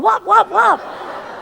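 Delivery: high, slow playful wop sounds with a laugh; laughing as she mimics the pan blows; mock frying pan whacks. A girl fantasizing about hitting her father, laughing mock whacks.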